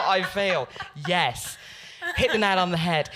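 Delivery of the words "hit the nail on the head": normal talking voice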